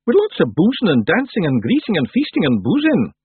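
loud, high Scots character voice; high, boisterous Feegle; hearty Feegle. Acted. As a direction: cheer it on loudly as a little Scot. Rob Anybody lists wake pleasures.

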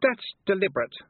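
frosty, haughty reply; cold. A narrator's reading. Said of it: "said Anagramma coldly".

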